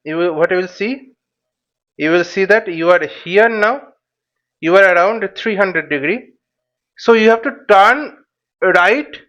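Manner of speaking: ordinary delivery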